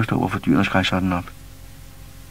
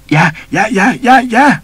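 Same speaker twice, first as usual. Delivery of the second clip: high, loud, panicked yelps; terrified scream; 11 dB louder than usual. A narrator's reading. Beaten man shrieks to Pus in terror. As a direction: shriek it out in fear, face in the dirt. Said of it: "skreg næren".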